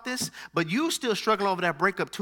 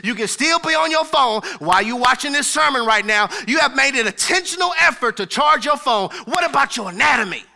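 louder, rising preacher cadence; louder, emphatic sermon delivery; preaching build; 4 semitones higher, 6 dB louder than usual. Real, not acted. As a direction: drive the point home to the congregation. Preaching at full force.

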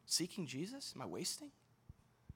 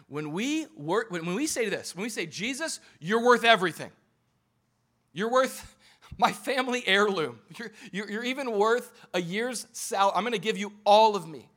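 high, loud impassioned preaching; full-force preaching; 6 semitones higher, 11 dB louder than usual. Acted. Pastor preaching at full force to his church. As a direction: preach it out to the congregation.

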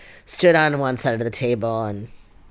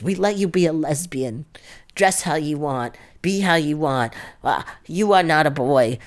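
dad going off, higher and louder; imitated angry rant; 4 semitones higher, 4 dB louder than usual. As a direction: retell a father's exasperated outburst. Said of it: "went off around that".

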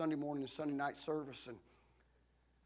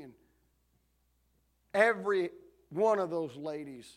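usual speaking voice

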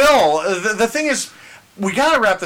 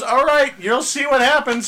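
higher, defiant Richard voice; Richard's voice; 7 semitones higher than usual. Retold or imitated. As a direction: puff up like a stubborn dad. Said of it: "So finally he goes".

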